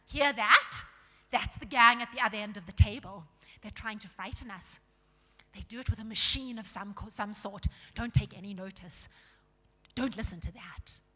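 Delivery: loud, gruff warning; suspicious dwarf. Acted. Dwarves dismissing the growl to one another, suspicious. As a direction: confide it loudly and dismissively.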